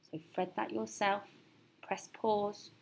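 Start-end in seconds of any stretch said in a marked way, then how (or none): none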